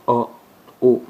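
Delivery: O as a pure round vowel; letter sound O. Shown as a demonstration. Teacher drilling the O sound, clear demo. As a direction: hold O open and round. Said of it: "se prononce O".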